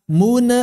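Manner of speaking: "mun" with a bounced n, like "mune"; nun memantul salah. Shown as a demonstration. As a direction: release the n of "mun" into a short vowel. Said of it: "Nggak dibaca". ("mun" dengan nun dipantulkan seperti "mune")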